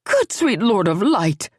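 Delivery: a mother aghast at the uproar; shocked exclamation. Acted. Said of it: "Loriana exclaimed".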